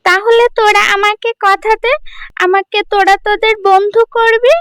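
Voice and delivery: put-on young girl asking, curious; girl's voice. Girl Priya asking the old woman who she is. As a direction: ask who's there, like a young girl.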